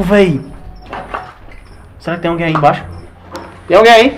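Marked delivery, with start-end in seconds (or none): 3.7-4.2 loud call into the dark. Real, not acted. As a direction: call out down the hole. Calling into a hole below.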